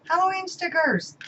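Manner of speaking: high, thrilled repeat of the words; delighted squeal. Real, not acted. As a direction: pitch jumps way up in sheer delight. Delighted by stickers in package.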